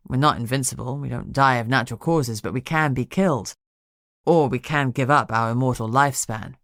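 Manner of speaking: steady, concerned dragon man; Emery's voice. A narrator's reading.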